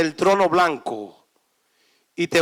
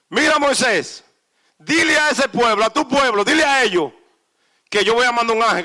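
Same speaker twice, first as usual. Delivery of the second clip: raised, loud voice of God giving orders; booming divine command, fast at times; 4 semitones higher, 7 dB louder, faster than usual. Acted. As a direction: speak as God, firm and loud. God voiced speaking to Moses.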